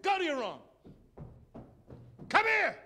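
a loud, high mock mother's yell; mom yelling. Mother yelling at her stomping kid, loud and high. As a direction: bark it like a fed-up mom. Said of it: "Mom said".

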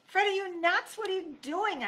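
high, loud alarmed yell. A narrator's reading. Narrator yells at Fred for taunting the knight, loud and high. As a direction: yell at a reckless friend. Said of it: "I yelled".

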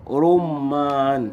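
رمان slowly stretched, rum-maan; drawn-out word. Shown as a demonstration. Teacher answering which word has ra damma, drawn out. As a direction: draw out each syllable of رمان. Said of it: "راء ضمة في كلمة إيه؟".

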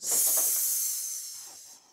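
long, unvoiced "S", sssss; hissed S sound. Shown as a demonstration. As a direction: sustain "S" as a pure sss, not the letter name. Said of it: "la lettre S chante". (« S » chanté : sssss prolongé)

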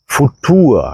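Futur ending in an open a, no hard r; German Futur. Shown as a demonstration. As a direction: Futur said as Futua. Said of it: "അതാണ് മറ്റൊരു സംഭവം".

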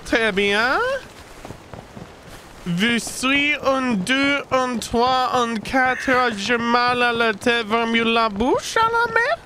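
hammed-up French chef accent; mock French, rolling and theatrical. Mock French gibberish speech.

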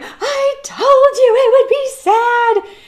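high, slow, wailing mock-cry of a little kid; a hammed-up, tearful wail; imitating a sobbing child. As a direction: re-enact your teenage self sobbing in the theater. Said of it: "And I'm bawling".

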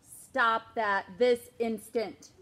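slow, loud, clipped scolding; stern order. Acted. Mom stopping David, slow loud command. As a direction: lay down the law word by word.

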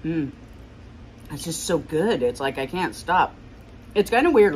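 eating between words; chewing while talking. Real, not acted. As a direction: mouth partly full of food. Seen 4: Bite at -5; chewing motions continue while talking over the bowl.

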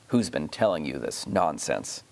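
low thunderous demand, held back; a deep, dark threat from a tyrant uncle. A narrator's reading. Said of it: "said the king in a voice of thunder".

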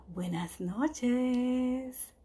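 very slow whisper. Shown as a demonstration. Teacher bidding the dinosaur goodnight, slow whisper. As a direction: breathe goodnight to a sleeping child. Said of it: "ya está dormido el dinosaurio".

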